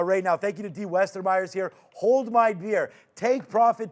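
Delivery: ordinary talk, nothing marked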